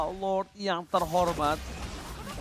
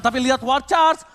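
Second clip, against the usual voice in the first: excited play-by-play; 6 semitones higher, 6 dB louder than usual. Real, not acted. Caster hypes the war charge.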